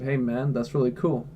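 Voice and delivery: ordinary talk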